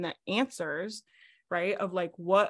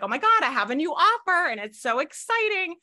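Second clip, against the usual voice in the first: higher, louder gush, imitating an overexcited client; mock gushing client; 8 semitones higher, 6 dB louder than usual. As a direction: play up the client's giddy excitement. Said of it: "they're like".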